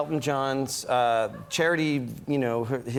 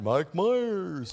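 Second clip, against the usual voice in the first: drawn-out TV announcer call; imitated announcer, name stretched out; Don Pardo; 2 semitones higher than usual. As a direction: announce the name like a show's opening credits. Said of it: "when Don Pardo went".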